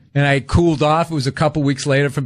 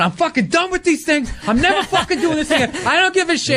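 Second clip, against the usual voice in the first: pitched-up mock rant, swearing at the agent; retold blowup; 12 semitones higher than usual. Comic telling his college agent how fed up he is, mock rant. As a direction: act out flipping out on the phone. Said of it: "flipping out".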